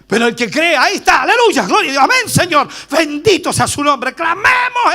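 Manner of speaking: grito de alabanza, fuerte y agudo; predicación a plena fuerza. Acted. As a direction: clama con fervor pentecostal. (alabanza gritada a todo pulmón)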